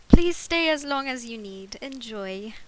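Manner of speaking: put-on projected amateur British accent, easing off at the end; guessed British accent. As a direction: have another clumsy go at British. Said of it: "try and guess what the hell a British accent".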